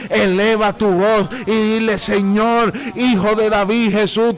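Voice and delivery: relentless, full-voiced exhortation; fervent preaching. Real, not acted. Preacher exhorting sick listeners to call on God, fervent preaching. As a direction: urge the sick to cry out, driving on without letup.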